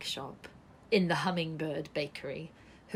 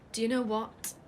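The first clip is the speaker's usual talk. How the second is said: ordinary delivery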